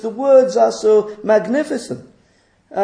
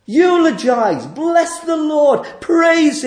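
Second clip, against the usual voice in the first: exhortation; 6 semitones higher, 3 dB louder, slower than usual. Real, not acted. Urging the congregation to praise.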